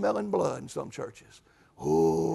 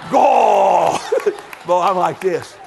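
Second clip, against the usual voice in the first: loud, slowed, shouting preacher with laughter; preaching like this; 10 dB louder, much slower than usual. Shown as a demonstration. Showing the church how he preached that Sunday, shouting. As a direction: belt it out like a fired-up preacher. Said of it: "that Sunday I was preaching like this".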